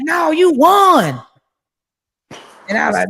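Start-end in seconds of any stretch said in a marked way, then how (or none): 0.0-1.2 loud, slow retold shout; retelling her friend yelling the news on the phone; Juice shouting. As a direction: belt it out like a hyped friend. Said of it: "And then Juice said".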